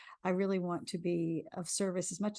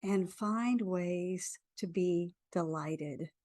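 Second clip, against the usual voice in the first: guided affirmation. Acted. Leads a tapping affirmation.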